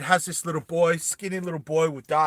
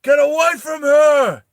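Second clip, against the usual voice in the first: high full-voiced shout; yelling out; 12 semitones higher, 12 dB louder than usual. Hammy trying to get Vas back, yelling. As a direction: yell it, stretching the last word. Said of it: "he starts yelling out".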